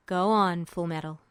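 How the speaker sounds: put-on cool, unreadable older officer; a calm, low voice from the end of the table; Mustang's voice. A narrator's reading.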